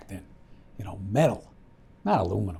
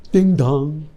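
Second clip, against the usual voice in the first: loud, higher sung-out doorbell chime; doorbell imitation; 4 semitones higher, 12 dB louder than usual. Man telling how his father came to the door, imitating the doorbell. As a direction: call out the chime loudly.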